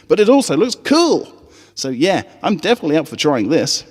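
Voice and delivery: a doubtful little pony teasing her friend; high filly voice. Acted. Scootaloo doubting Apple Bloom's speech, high and skeptical.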